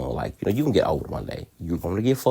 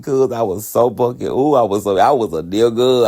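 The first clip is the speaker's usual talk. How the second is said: normal talking voice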